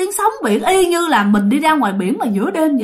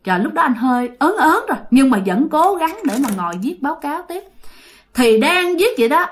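ordinary talk, nothing marked

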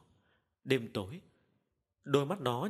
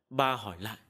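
ordinary delivery, nothing marked

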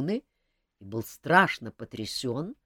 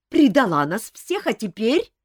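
angry; 8 semitones higher, 8 dB louder than usual. A narrator's reading. Countess accuses Elvira angrily.

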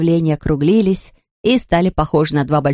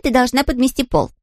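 bossy mistress voice; 6 semitones higher than usual. Acted. Mistress voice giving orders.

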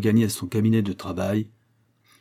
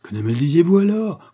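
higher and louder indignant cry; indignant outburst; 8 semitones higher, 7 dB louder than usual. A narrator's reading. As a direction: burst out in shocked protest. Bolton bursts out at demand.

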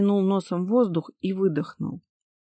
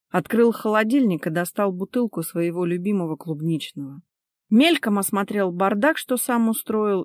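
usual speaking voice

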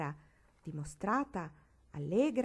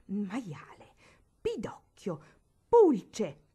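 building insults ending in a loud furious shriek; spat insults; 2 semitones higher, 9 dB louder, much slower than usual. Acted. Barbara in a fury hurling insults at Marigold, spitting rage. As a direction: spit each insult out, exploding on pulce. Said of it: "vomitò dalla bocca bavosa".

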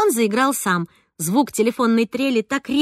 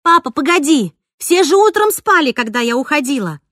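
excited protest; 4 semitones higher, 6 dB louder than usual. A narrator's reading. Excited daughter on phone.

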